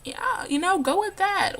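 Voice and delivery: high, loud mock agreement; humouring reply. Vlogger replaying her answer to the girls, humouring. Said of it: "It's like".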